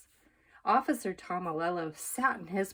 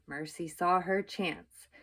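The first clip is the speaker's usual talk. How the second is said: usual speaking voice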